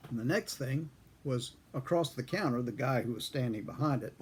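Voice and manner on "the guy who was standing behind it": usual speaking voice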